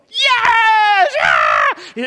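very high, very loud acted cheer; celebratory shouting. Preacher mimicking believers getting their answer, celebratory shout. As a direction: scream it like a wild celebration. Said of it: "then we go to shouting".

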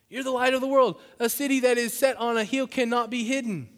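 high, loud scripture proclamation; impassioned preaching. Acted. Preacher reading the verse to congregation, impassioned.

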